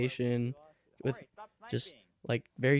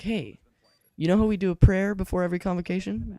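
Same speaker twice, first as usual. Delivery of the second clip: lightly voiced teacher, pitched up; a touch higher, as a teacher making a request; 6 semitones higher, 3 dB louder, faster than usual. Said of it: "one of my teachers is like".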